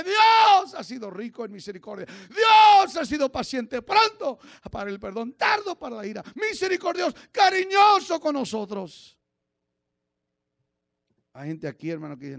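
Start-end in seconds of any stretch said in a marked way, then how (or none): 0.0-9.2 loud preaching surging into high shouts; full-force preaching. Real, not acted. Preacher proclaiming God's patience to the church, full-force shouting. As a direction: hit each mercy with a loud, high cry.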